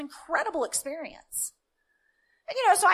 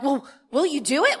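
excited eager people; 8 dB louder than usual. Retold or imitated. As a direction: press them eagerly to do it now. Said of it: "They got all excited".

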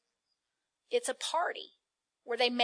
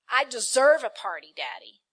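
loud, petulant demands with a pleading Daddy; whiny entitled son; 5 dB louder than usual. Acted. Elder son demanding a reward from his father, whining loudly. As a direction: whine at Daddy like a spoiled son. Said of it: "Gotta put it in some religious language".

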